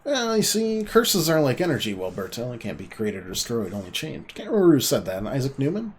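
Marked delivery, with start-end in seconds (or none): 0.0-1.9 high, bright-eyed lecture about curses; eager explaining. Acted. Machine expert eagerly explains curses, high and excited.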